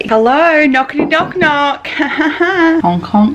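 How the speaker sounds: high, silly sing-song honking; silly clown. Pretend. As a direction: goof around like a clown. Playful knocking and honking noises.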